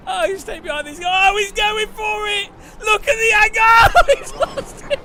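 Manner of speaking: real laughter-crying at the crashing AI. Real, not acted. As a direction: barely get it out for laughing. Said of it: "Oh, I'm crying".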